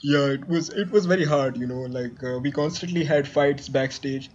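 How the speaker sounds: low, hesitant puppet guest; shadow musician guest. Acted. Plays the rock star's shadow guest.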